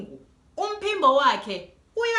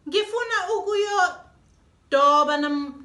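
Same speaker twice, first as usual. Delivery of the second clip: put-on slow, louder childish plea; child pleading; 5 semitones higher, 5 dB louder, slower than usual. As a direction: drag it out like a begging child. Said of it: "kusho uDudu".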